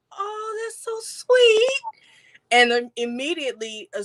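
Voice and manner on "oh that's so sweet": much higher, drawn-out sassy "Oh, that's so sweet"; sweetly sarcastic, stretching the words; mock-sweet sass, pitched way up. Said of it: "like, real sassy".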